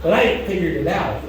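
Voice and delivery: ordinary delivery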